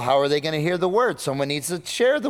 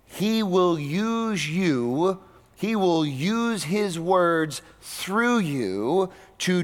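slow preaching; slower than usual. Acted. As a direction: preach it slowly, let each phrase land. Preacher builds slow emphatic passage.

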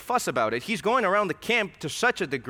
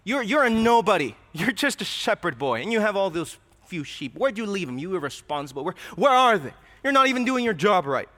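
mocking older brother; 4 semitones higher, 6 dB louder than usual. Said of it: "He's, he's like".

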